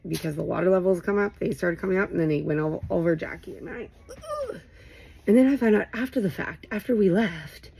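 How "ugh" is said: high, soft squeal of disgust; disgusted shudder. Real, not acted. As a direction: let out a quiet high-pitched shudder. Shudders at thought of spiders.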